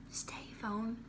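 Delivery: soft whisper at the phone; whispered command. Real, not acted. Propping up her phone, whispering it to stay put.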